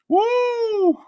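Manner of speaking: long high-pitched "woo" after laughing; high whoop. Real, not acted.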